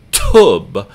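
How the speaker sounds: tub with a big puff of air on the T; overdone breathy English T in "tub"; English aspirated T. Shown as a demonstration. Teacher showing the wrong, English way, aspirated tub. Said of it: "like we would say it in English and puffing that piece of air out".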